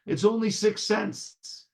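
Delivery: high, slow reassurance; lightly voiced peasant peddler; supposed Vasil. A narrator's reading. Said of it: "answers the supposed Vasil".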